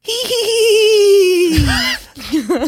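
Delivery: high shrieking witch cackle. Acted. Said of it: "Did I sound like a witch there".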